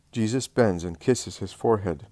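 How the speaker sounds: plain reading voice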